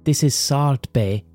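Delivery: usual speaking voice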